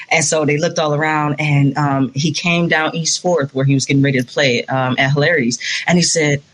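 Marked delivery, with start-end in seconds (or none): none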